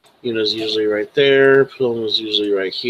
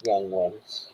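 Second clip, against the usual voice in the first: talking into a glass; 3 semitones higher than usual. Acted. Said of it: "Are you speaking into a glass?".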